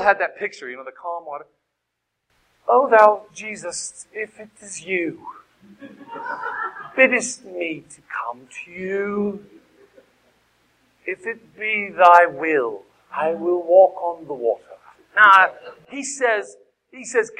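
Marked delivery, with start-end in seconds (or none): 2.7-14.6 put-on slow, solemn old-English intoning; King James voice. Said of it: "I always read it in King James".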